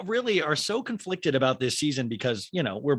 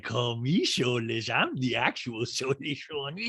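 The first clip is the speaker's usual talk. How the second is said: slow, slurred mock-celebrity voice; slushy-mouthed reality star caricature; Lisa Rinna. Reality star owns the insult, slurred impression. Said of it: "Rinna is just like".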